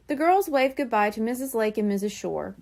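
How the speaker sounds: ordinary delivery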